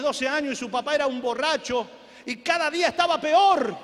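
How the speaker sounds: usual speaking voice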